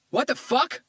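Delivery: high, loud startled shout; man caught in panties by cops; shocked yell. A narrator's reading. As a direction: blurt it out caught off guard.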